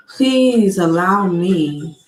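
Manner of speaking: usual speaking voice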